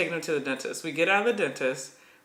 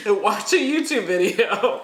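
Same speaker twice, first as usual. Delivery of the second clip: slow, a bit louder, through a laugh; exasperated laughing advice; 3 semitones higher, 4 dB louder than usual. Real, not acted. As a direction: laugh it out in disbelief.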